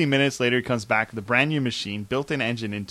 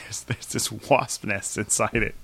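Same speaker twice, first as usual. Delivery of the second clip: ordinary talk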